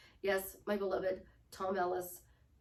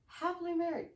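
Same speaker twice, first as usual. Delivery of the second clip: chin up, higher and proud; confident; 7 semitones higher than usual. Shown as a demonstration. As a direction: say it like you own it. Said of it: "pull your chin up".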